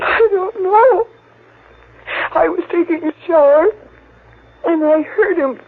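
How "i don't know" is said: slow, crying answer; tearful. Acted. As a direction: choke it out through tears. Grieving sister questioned by cop.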